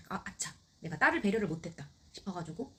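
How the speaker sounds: normal talking voice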